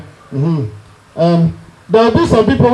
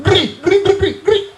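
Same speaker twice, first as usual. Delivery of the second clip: imitated fight noise; 10 semitones higher than usual.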